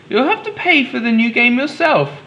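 high, motherly storybook mum. Acted. Mum telling Daniel to pay himself, high storybook voice. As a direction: lift into a bright high mum voice.

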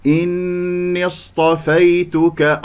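slow chanted إني اصطفيتك, joined straight into the saad; linked hamzat wasl. Shown as a demonstration. Teacher showing the linking, slow joined chant of إني اصطفيتك. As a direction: link إني into اصطفيتك without a break. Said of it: "لأن لدينا همزة وصل".

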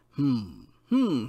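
self-important doctor's "hmm, hmm"; throat-clearing hum. A narrator's reading. Doctor pleased at finding the rooster clears his throat, pondering hums. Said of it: "cleared his throat again".

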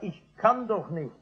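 normal talking voice

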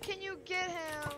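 high, slow, drawn-out repeat of a request; pointed self-quote. Retold or imitated. Player quoting their ignored request to a teammate, drawn-out pleading. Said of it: "I said".

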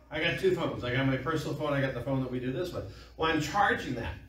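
ordinary delivery

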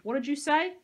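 loud, high challenge, rising at the end; shouting. A narrator's reading. Peyton challenging Dean to repeat himself, shouting. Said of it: "Peyton shouted".

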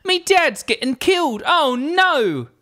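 high, slow hammed-up Cockney; Cockney geezer rant, high and drawn out; Cockney nut job. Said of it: "a Cockney nut job".

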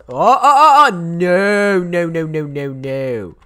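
frantic oh-no panic, fading out; panicked cry. Real, not acted. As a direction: horror as the killer closes in. Sees the killer coming.

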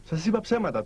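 usual speaking voice

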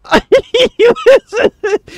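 loud, high burst of real laughter. Real, not acted. Gamer to viewers, caught off guard, loud high laugh. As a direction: howl with laughter.

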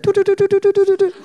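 very high sing-song doo doo doo; squeaky quick little footsteps; imitating her tiny steps, high and bright; mock tiny trotting steps. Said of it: "'Cause she's like".

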